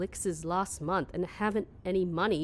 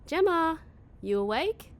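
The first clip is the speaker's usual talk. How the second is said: high, loud call through the house; 12 semitones higher, 4 dB louder than usual. Acted. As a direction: call her name to wake her. Calling roommate from another room.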